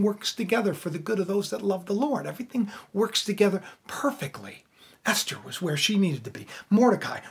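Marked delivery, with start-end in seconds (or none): 2.3-4.6 hushed whisper. Real, not acted. Preacher whispering God's perfect plan to listeners. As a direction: breathe it like a secret.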